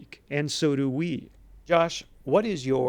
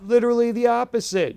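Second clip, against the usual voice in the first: high, louder burst of amused disbelief; excited exclamation; 10 semitones higher, 6 dB louder than usual. Real, not acted. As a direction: blurt it out, delighted. Amused reaction to mismatched outfit.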